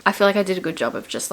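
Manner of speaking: usual speaking voice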